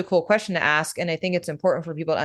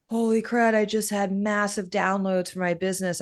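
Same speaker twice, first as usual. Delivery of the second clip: higher-pitched, imitated amazed blurt; retold excitement; 5 semitones higher than usual. Teacher imitating business owners after a breathwork class, excited amazement. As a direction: play clients bursting with a revelation. Retells clients blurting out their inspiration.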